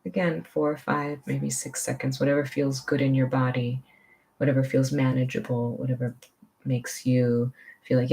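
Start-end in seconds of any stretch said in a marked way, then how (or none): none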